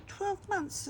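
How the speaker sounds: hearing how long her son will be without a license; stunned disbelief. Retold or imitated. A mother retells the specialist's ruling, stunned and high. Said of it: "And I went".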